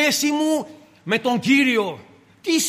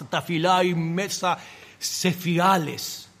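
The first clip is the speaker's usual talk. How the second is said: normal talking voice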